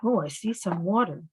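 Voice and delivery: usual speaking voice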